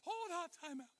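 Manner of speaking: squeaky high cartoon-character yelp; a startled cartoon squeak. Real, not acted. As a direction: voice cracking up high by accident. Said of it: "I sound like a cartoon character".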